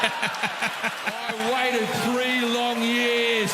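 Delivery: slow, stretched-out words; drawn-out. Acted. Comic milking three years of waiting, very slow. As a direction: drag out the wait for the laugh.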